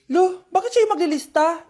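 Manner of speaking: high, loud objection; indignant protest. Acted. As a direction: object loudly like a miffed classmate. Student objects to classmate as monitor. (pasigaw na reklamo)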